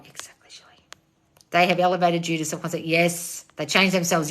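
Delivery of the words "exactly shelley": soft unvoiced whisper. Real, not acted. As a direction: breathe it out. Whispered aside.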